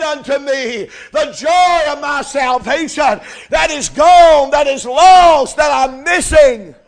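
preacher voicing David's prayer at full force; preached prayer. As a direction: pray it out with mounting fervor. Preacher voices prayer, climbing.

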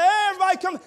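loud high call to the family; preacher calling relatives down the hall. Retold or imitated. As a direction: shout them back into the room. Calling family back into room.

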